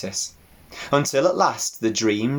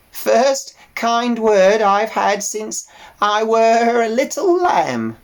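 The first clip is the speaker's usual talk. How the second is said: put-on slow, high bleating old sheep; old ewe voice; 12 semitones higher, 6 dB louder, slower than usual. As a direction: drawl it like a tired old ewe.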